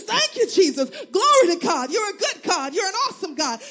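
loud, high, joyful thanks to God; joyful praise. Retold or imitated. Church woman thanking God for groceries, loud and joyful. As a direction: shout praise like a woman overjoyed.

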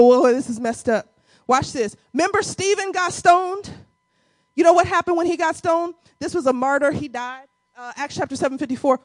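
ordinary talk

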